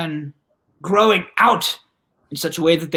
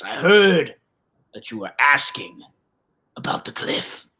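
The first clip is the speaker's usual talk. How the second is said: put-on grizzled old man; 7 semitones lower than usual. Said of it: "a grizzled older gentleman".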